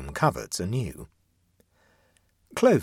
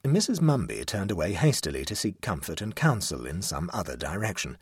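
ordinary delivery

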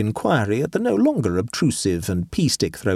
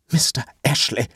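barely contained outrage; quivering with indignation; shaking voice; 4 semitones higher than usual. A narrator's reading. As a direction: address him in a shaking, indignant voice. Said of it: "said Adela in a shaking voice".

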